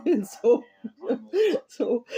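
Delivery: high laughing through the words. Real, not acted. Tarot reader laughing at her own loud voice.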